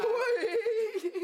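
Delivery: high-pitched, unconvincing yes; strained high yes. Acted. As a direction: squeal out the yes. Insists all is well, strained. (oui aigu et forcé)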